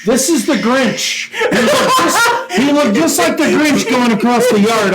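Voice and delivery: high words breaking up with laughter; laughing description. Real, not acted. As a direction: tell it while cracking up. Cracks up describing the sneaking.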